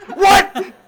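very high squeal then long laugh; shrieking laugh. Pretend. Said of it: "here's how I would respond".

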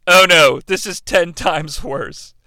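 ordinary delivery

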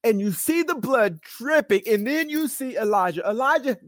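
high, insistent pulpit delivery; impassioned preaching. Real, not acted. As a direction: paint the scene for a congregation, pitched high.

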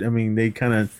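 ordinary delivery

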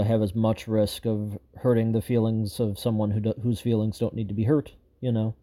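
ordinary delivery

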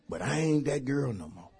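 ordinary talk, nothing marked

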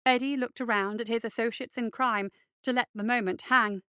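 plain reading voice

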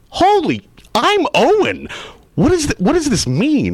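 can't believe what you just read; stunned shock. Retold or imitated. Actor alone in a hotel realizing he is coming back, stunned.

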